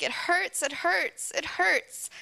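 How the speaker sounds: high-pitched wail, imitated without real volume; mock crying yell. Camper yelling at her counselor after going underwater, mock pained cry. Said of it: "she's crying, and she's screaming, and she's yelling at me".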